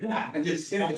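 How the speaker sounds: laughing through a teasing line. Real, not acted. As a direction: giggle out the joke. Teasing joke about accent.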